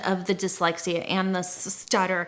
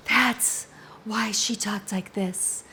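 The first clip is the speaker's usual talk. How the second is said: Marilyn Monroe voice. Shown as a demonstration. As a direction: slip into a hushed, airy screen-siren whisper. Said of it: "'Cause if you talk like this".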